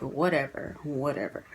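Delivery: low, dismissive brush-off. Retold or imitated. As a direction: wave it away, low and flat. Brushing off the situation.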